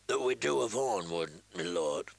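cringing rogue answering his captor; lowly prisoner. A narrator's reading. Prisoner answers the lord humbly, low and servile. Reek voice again.

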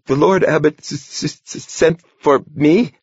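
high, stuttering frightened squawk; stammering squawk. Acted. Novice squawking nervously at his abbot's door. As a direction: trip over the s in terror. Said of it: "squawked the novice".

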